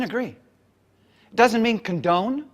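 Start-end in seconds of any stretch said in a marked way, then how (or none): none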